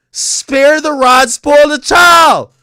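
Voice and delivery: loud slow emphatic shout; shouted proverb. Real, not acted. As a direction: yell the saying like a sermon. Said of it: "my mama would have probably beat me".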